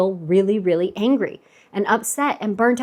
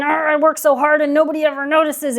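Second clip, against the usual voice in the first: higher, exasperated grumble over the pots; a hammed-up resentful moan at the sink; mock angry grumble; 5 semitones higher, faster than usual. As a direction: gripe that nobody ever notices. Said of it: "I'm getting angry and I'm getting upset".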